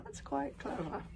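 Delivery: ordinary delivery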